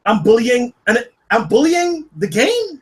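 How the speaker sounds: high-pitched, rising disbelief, retold; incredulous retort. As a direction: act out baffled indignation at an absurd charge. Retold reaction to a ban.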